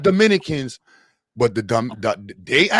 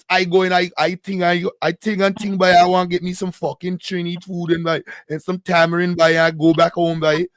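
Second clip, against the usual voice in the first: mock Trinidadian singsong, pitched up; badly faked Trini accent, piling on the slang; hammed-up Trinidadian impression; exaggerated Trini accent; 2 semitones higher than usual. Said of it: "I can't do the Trini accent".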